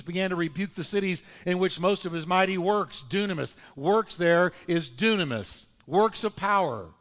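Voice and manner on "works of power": normal talking voice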